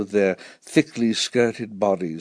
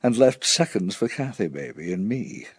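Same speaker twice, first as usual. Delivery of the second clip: ordinary delivery, nothing marked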